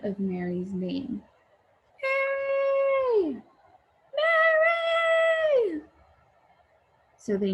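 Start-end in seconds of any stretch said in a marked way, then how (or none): 2.0-5.8 very high, louder long calls; frantic calling. Acted. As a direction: call her name across the whole neighborhood. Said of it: "Their calls grew louder and louder".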